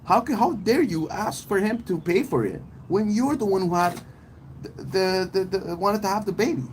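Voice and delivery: outraged high-pitched rant; heated retort. Retold or imitated. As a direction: talk her down, pitch way up. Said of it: "I'm like".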